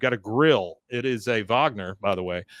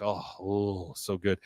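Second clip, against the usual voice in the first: soft moan of delight; 5 semitones lower, 8 dB quieter than usual. Retold or imitated. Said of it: "she's like".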